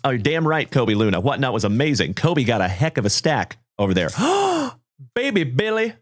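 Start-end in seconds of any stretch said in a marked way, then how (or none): 4.1-4.7 very high surprised gasp; sharp gasp. Real, not acted. Gasp at amazing stack. 5.1-5.9 much higher playful exclamation of the name; amazed high call. Real, not acted. Mock-amazed greeting.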